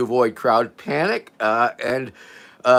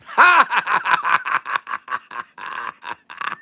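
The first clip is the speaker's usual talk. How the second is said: real belly laugh at a joke; real laughter; 4 semitones higher than usual. Real, not acted. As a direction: laugh long till it runs out. Laughs at the joke just read.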